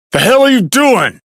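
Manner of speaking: alarmed demand. Acted. Confronting someone over what they are doing, alarmed demand. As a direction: demand it with pitch leaping way up.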